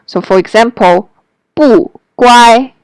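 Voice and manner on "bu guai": falling "bu" before "guai"; changed fourth tone. Shown as a demonstration. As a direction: start "bu" high, let it fall before "guai". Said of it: "the fourth tone".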